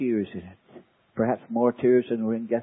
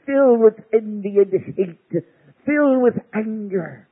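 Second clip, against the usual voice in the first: much higher and louder, slow and biting; intense emphasis; 8 semitones higher, 7 dB louder than usual. Acted. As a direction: spit out the hatred slowly. Describing hateful devils.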